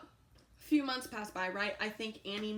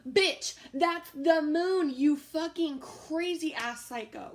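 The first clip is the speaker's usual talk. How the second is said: loud, high, exasperated shout; worked-up outburst; 5 semitones higher, 9 dB louder, slower than usual. Retold or imitated. Storyteller reacts to roommate's moon picture, loud worked-up outburst. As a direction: explode in disbelief, stretching the words out slowly. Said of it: "this just gets me worked up".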